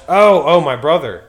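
loud, high burst of realization; excited "oh, I've got it!" outburst; sudden remembering. Real, not acted. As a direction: blurt it out as the memory comes back. Suddenly remembers his lost point.

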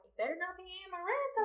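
playful imitation of a nervous friend; mock worried friend. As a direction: rattle it off in a panic. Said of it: "Moore's probably like".

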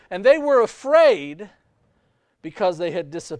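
ordinary delivery

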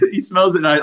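ordinary delivery

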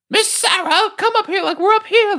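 very high, far-off creature call; Ludo voice. Retold or imitated. Ludo calling up to Sarah in a very high creature voice. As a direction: call up to her like a gentle beast.

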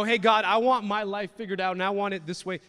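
loud, high, pushy prayer to God; a congregant demanding God's plan; mock demand. Congregant demanding from God, loud and high.

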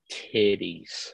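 low, hushed, dazed "Titties."; low hushed awe; awestruck kid. Retold or imitated. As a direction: breathe it out like a stunned first-timer. First time in a strip club.

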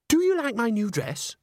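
put-on high, girlish gush; gushing exclamation. A narrator's reading. Christine shows Agnes her new dress, exclaiming. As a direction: show off in an excitable high voice. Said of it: "she exclaimed".

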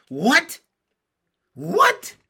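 loud, high, rising yelp of surprise; children's incredulous outburst; astonished cry. A narrator's reading. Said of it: "cried all the children at once".

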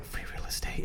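soft unvoiced whisper. Friend mimicking Rebecca eyeing her chance, whispered. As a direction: breathe it like a sly aside. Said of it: "Rebecca's like".

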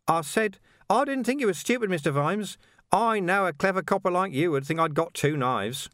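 high, chirpy villain with a laugh; mock innocence, grinning high; cheerful menace, high-pitched; Carcer's voice. A narrator's reading.

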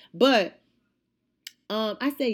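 plain reading voice

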